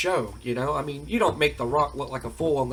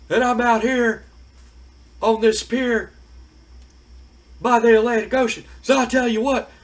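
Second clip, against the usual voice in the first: high, loud mocking shout of What?; mocking Stone Cold yell; 8 semitones higher, 5 dB louder than usual. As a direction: yell it back like you can't hear him on purpose. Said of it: "And Stone Cold's like".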